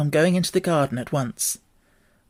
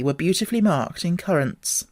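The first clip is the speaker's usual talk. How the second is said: ordinary delivery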